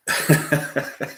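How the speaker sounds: real high chuckle; short run of light laughing. Real, not acted. Player amused after slamming a movie, real chuckle.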